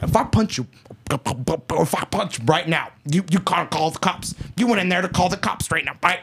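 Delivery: garbled, stumbling imitation of an angry man; mimicked tough talk, tripping over words; mock speech impediment. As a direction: stumble and slur through an empty threat. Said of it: "he had a speech impediment".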